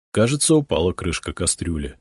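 usual speaking voice

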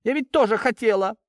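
high-pitched, near-tearful complaint; wounded protest. A narrator's reading. Daughter pleading her hurt to her father, high and wounded. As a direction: protest as if about to cry. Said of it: "Её губы задрожали".

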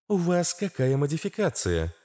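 high female inquiring voice; inquisitive Astra. A narrator's reading. Astra asks about the battery model, high curious voice. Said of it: "поинтересовалась Астра".

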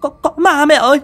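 high, loud, stuttering scream; terrified shriek. A narrator's reading. Widow calling to mother about a ghost, shrieking. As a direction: cry out in horror, tripping over repeated words. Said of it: "Chị Dung kinh hãi rú lên".

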